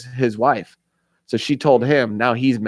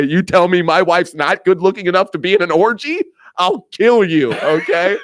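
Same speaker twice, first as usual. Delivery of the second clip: raised-pitch indignant husband, lightly hammed up; mock angry threat; 6 semitones higher, 4 dB louder than usual. Offended husband threatening over his wife, mock angry. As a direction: build indignation into a loud comic threat.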